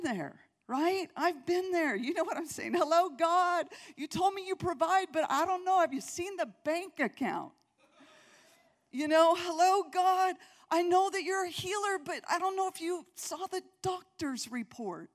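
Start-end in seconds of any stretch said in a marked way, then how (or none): 2.7-7.5 raised, pleading voice to God, half-joking; mock worried prayer. Preacher voicing a doubter praying to God, pleading. 9.3-15.1 raised, pleading voice to God, half-joking; mock worried prayer. Preacher voicing a doubter praying to God, pleading.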